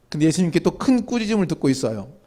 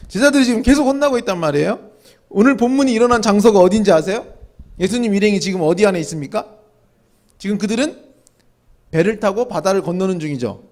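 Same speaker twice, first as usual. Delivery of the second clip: ordinary talk, nothing marked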